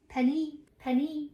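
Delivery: Penny in a light French accent. Shown as a demonstration. Trying out the name Penny, French accent. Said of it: "like, a French accent".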